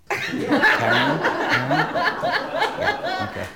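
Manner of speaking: high laughing repeat; giggling through the words. Real, not acted. Laughing at own pun.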